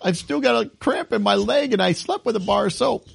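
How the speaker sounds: high-pitched mock patient pleading with auntie; complaining patient. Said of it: "I just picture going in".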